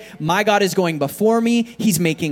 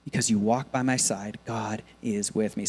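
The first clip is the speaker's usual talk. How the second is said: hushed statement of faith; quiet, sincere declaration; 6 semitones lower, 6 dB quieter than usual. Acted. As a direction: say it softly to yourself.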